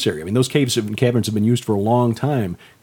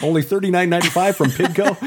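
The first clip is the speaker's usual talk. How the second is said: mock sales pitch, pitched up; laughing mock ad; 7 semitones higher than usual. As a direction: laugh while reading the ad. Mock ad price.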